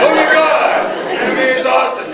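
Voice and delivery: squealing high with excitement; excited. Retold or imitated. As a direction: gush like a sudden superfan. Imitating an excited anime convert.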